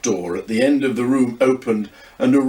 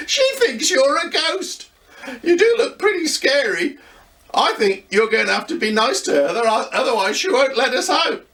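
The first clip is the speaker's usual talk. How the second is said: put-on higher, lively young boy voice; boy Luca; 13 semitones higher than usual. A narrator's reading. Luca teases grandad about looking scary, young boy voice. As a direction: play a cheeky young boy teasing grandad.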